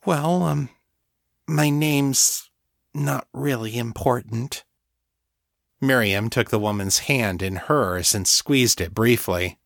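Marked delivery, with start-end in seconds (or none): 0.0-4.6 higher, hesitant, awkward voice; uncomfortable. A narrator's reading. Thrall avoiding her mistress's question, uncomfortable. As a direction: falter awkwardly and play it down. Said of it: "sounding suddenly uncomfortable".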